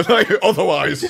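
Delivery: slow word broken by laughter; cracking up mid-word; laughing. Real, not acted. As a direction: say it through real laughter. Laughing at the crude joke.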